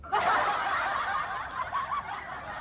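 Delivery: a burst of high real laughter. Real, not acted. Mocking the Jordan fans who report him, high laughter.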